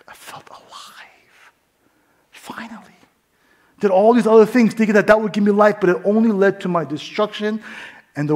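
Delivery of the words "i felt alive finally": whispered and slow, barely there; awed whisper. Real, not acted. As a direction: let it out like a held breath. Quiet climax of testimony.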